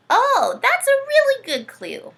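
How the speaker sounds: thrilled teacher praising a clue; excited. Acted. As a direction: gush over the clue. Reacting to puppet's clue.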